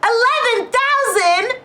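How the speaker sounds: very high, loud squeal of amazement; joyful disbelief. Real, not acted. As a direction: shriek it as an astonished question. Disbelief at the sale price.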